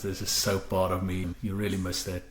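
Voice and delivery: ordinary delivery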